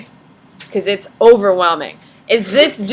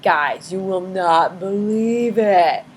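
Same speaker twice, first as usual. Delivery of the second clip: mock awed Frenchman; 3 semitones lower, 4 dB quieter, slower than usual. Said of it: "Just like".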